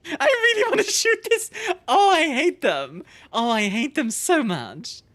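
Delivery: high, loud, slow laughing groan; laughing exasperation. Real, not acted. Streamer fuming at the alien, laughing and loud. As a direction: let the frustration spill out in laughter.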